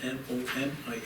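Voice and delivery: letter by letter; spelling it out. Real, not acted. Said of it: "I'm gonna ask you to spell it for me".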